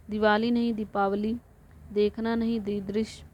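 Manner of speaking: ordinary talk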